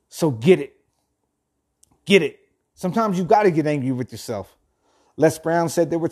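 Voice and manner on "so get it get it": short, louder self-command; yelling at oneself. Real, not acted. Host pushing himself, emphatic self-directed yell. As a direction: punch it out to spur yourself on. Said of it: "I'm yelling at myself for that".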